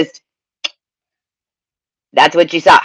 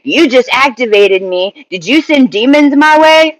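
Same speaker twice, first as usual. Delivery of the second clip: mock yelling; 6 semitones higher than usual. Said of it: "you come yelling at me".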